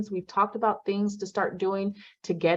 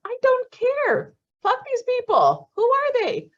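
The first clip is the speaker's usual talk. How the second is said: much higher and louder, slow and emphatic; high-pitched, drawn-out defiance; defiant exclamation; 4 dB louder, slower than usual. Real, not acted. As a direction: throw it out like you truly don't care. Defiant stand against beach crowd.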